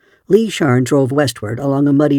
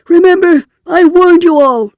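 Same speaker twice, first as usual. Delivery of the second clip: very high, loud, wild-eyed shout; shrill screech; 8 dB louder than usual. A narrator's reading. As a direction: shriek the warning at the crowd. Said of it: "he shrilled".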